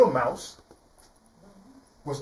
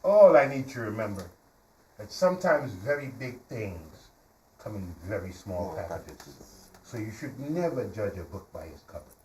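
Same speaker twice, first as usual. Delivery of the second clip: gentle moral; 6 semitones lower than usual. Acted. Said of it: "the little mouse looked at him and said".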